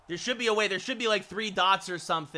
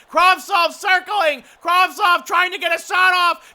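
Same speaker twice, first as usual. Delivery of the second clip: high, loud, urgent hockey call; excited play-by-play; 11 semitones higher, 12 dB louder than usual. Acted. Commentator calling one last chance at the empty net, high urgent shouting. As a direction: shout the action as it unfolds.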